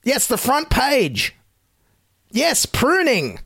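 high, slow, drawn-out emphasis; mock insistence. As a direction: stretch each key word in fake amazement. Insists mockingly on the trivial scoop.

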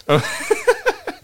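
squealing laugh; high-pitched laugh. Real, not acted.

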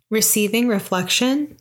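ordinary talk

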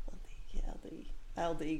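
soft whispered L D calibration; whispered warm-up. Shown as a demonstration. A guest warms up for an accent in a whisper. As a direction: whisper the warm-up letters to yourself. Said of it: "I need to calibrate as well".

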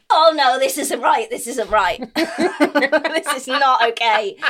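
rising alarmed voice, laughing through the end; a giggling mock panic; panicked self-talk.

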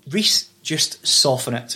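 slow soothing producer line; slow, softened advice. Retold or imitated. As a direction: draw it out gently, like a producer calming him. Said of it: "they're gonna go".

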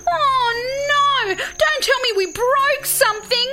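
high, panicky koala wail; hammed-up storybook panic; alarmed exclamation. A narrator's reading. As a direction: cry out in dismay. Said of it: "Kai exclaimed".

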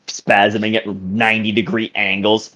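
usual speaking voice

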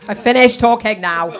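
giggling through embarrassed words; laughing. Real, not acted. Embarrassed after explaining a bake.

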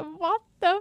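high, loud mock-sobbing wail; crying and screaming. Retelling own reaction to the series, tearful screamed plea. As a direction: wail in hammed-up despair, voice high. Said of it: "gråter och skriker".

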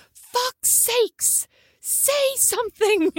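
exasperated friend. Retold or imitated. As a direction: squeak it out like a fed-up friend. Friend Julie scolds at lunch.

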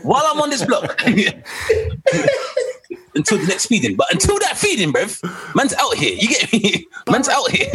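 high, giddy, laughing through it; cackling lads' night boast; laughing hype-man voice. Pretend. Mock hyped dad on night out.

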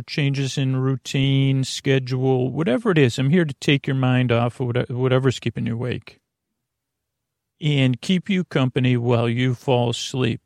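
ordinary talk

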